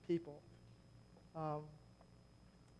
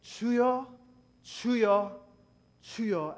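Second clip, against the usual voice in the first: repeated calling; 3 semitones higher, 8 dB louder than usual. Shown as a demonstration. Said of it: "So what they do is they say".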